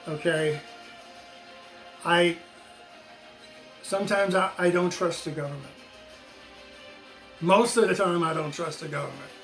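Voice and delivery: normal talking voice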